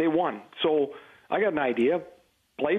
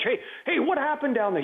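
mock yell; 9 semitones higher than usual. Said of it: "He'd yell down to".

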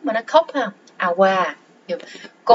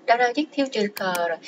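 usual speaking voice

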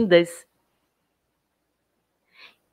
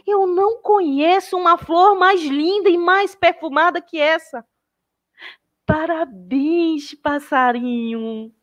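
much higher, gushing with wonder; showering the bird with amazed praise; delighted praise; 9 semitones higher, 4 dB louder than usual. Acted.

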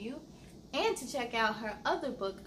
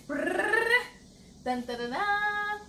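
high playful drumroll noise, brrr then dun dun dun; mock drumroll; 9 semitones higher, 5 dB louder, slower than usual. Host teasing kids before the title, playful drumroll. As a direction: build suspense with a silly vocal drumroll.

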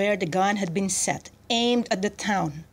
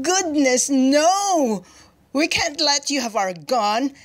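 high, louder exclamation; amused refusal; 6 semitones higher, 4 dB louder, slower than usual. A narrator's reading. Prime minister turning down the general, amused refusal. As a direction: dismiss the request with lofty amusement, pitched up.

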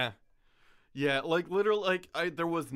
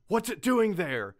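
mock-frightened, higher and louder; very scared voice; 6 semitones higher, 5 dB louder than usual. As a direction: retell it in an exaggerated scared voice. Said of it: "in a very scared voice".

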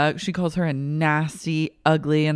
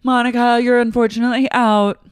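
high, loud game host verdict; loud announcer; 8 semitones higher, 6 dB louder than usual. Retold or imitated.

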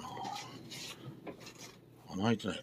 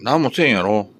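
higher, louder coaxing to an angry pet; 3 semitones higher, 9 dB louder than usual. Real, not acted. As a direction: coax the grumpy pet sweetly. Reassuring the pet.

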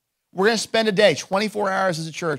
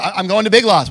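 louder, higher, a touch frantic; mock urgency; 4 semitones higher, 8 dB louder than usual. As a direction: act out a hurried announcement to family. Acted exchange at dinner table.

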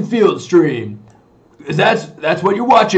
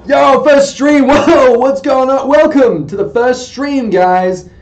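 loud, higher-pitched hype welcome; excited greeting; 5 semitones higher, 6 dB louder, slower than usual. Real, not acted. Streamer welcomes guys to the first stream, loudly hyped. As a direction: burst in with big stream energy.

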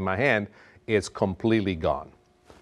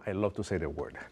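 hushed, low little confession; quiet aside; 4 semitones lower, 10 dB quieter than usual. Real, not acted. Chef confiding his love of the French word, quiet aside. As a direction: drop soft and low, privately savouring it.

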